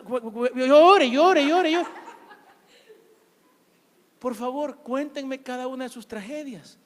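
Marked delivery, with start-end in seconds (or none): 0.0-1.9 loud, high, slow stutter into a yell; stuttered mock shout. Preacher mockingly urging a jobless listener to cry, loud stuttering shout. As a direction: stammer then shout it out mockingly.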